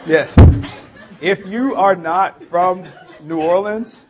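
ordinary talk, nothing marked

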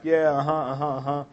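mock agreeable nodding-along; feigned understanding. Friend bluffing through a conversation he can't follow, feigned understanding. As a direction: imitate someone pretending to follow along. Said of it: "trying to act like he knew".

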